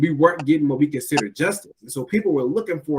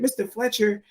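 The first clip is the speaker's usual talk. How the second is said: kindergartner voice; 8 semitones higher than usual. Retold or imitated. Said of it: "and said".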